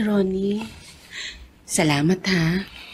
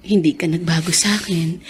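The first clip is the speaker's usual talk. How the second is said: ordinary delivery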